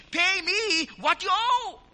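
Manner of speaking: loud, high, slow demand; forceful demand. Acted. Creditor seizing his fellow servant by the throat, forceful demand. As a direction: demand it slowly at full volume, drawing out each word. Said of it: "took him by the throat".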